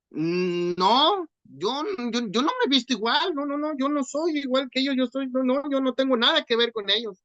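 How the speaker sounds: high, panicky stammered denial; nervous denial. Retold or imitated. Peter nervously denies Jesus.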